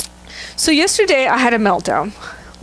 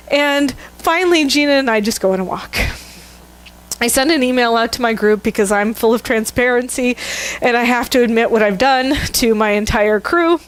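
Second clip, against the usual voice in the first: ordinary delivery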